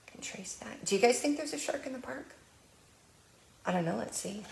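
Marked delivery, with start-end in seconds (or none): 0.1-0.8 soft whispered aside. Acted. Librarian whispering while tracing the page. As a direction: breathe it while tracing along.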